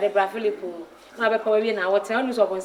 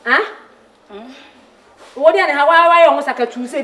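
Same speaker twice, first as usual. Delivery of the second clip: loud, raised insistent question; angry demand; 4 semitones higher, 8 dB louder than usual. Acted. Demanding an answer from the crying woman, angry shout. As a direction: hit the repeated question much harder. Repeats question louder when unanswered.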